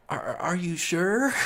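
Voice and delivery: slow, high-pitched incredulous question; skeptical, rising high at the end; mock doubt. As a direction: draw it out high, doubting him. Mocks drunk friend's claim to walk.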